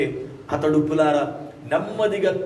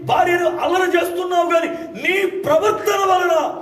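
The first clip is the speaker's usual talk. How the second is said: high, loud revival preaching; preaching at full force; 12 semitones higher, 3 dB louder than usual. Acted. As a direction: proclaim it to the whole congregation.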